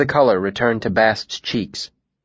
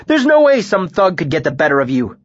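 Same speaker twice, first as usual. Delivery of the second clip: raised, insistent voice; emphatic; 7 semitones higher, 4 dB louder than usual. A narrator's reading. Bast defends his master's fighting skill, emphatic. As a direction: insist loyally, pitched up. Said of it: "he said emphatically".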